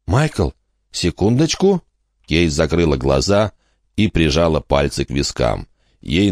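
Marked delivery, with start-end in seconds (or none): 0.1-1.8 put-on higher girl voice, asking to wait; a girl cutting in quickly; girl's voice. Kate stops Michael.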